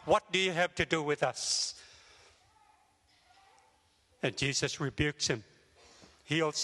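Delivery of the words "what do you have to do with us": usual speaking voice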